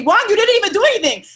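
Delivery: high, loud, fast mock scolding. Guest playfully scolding Juan for not helping. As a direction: tell him off in play.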